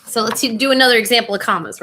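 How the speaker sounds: usual speaking voice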